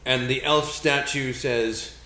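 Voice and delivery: ordinary talk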